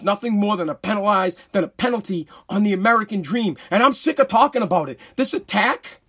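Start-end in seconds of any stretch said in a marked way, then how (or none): none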